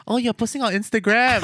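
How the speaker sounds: high, loud voicing of a friend; retelling a friend's reply, high and loud. Friend voiced in retold scene.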